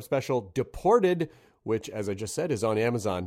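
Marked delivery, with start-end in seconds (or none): 2.5-3.3 barreled through quickly; said really fast. Real, not acted. Host rushing a plug for a guest's special, said fast. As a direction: rattle it off in passing. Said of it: "I said it really fast".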